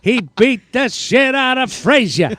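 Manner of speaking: high, slow boxing commentator; emphatic, drawn-out sportscaster impression; Howard Cosell. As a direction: drag out each phrase like ringside commentary. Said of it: "a little more, uh, Howard Cosell".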